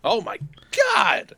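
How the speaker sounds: loud, high excited cry; voice up an octave. Real, not acted. Streamer telling co-host they are live, voice shooting up high. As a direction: burst out high and loud with the big news. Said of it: "Your voice went up a, a extra octave".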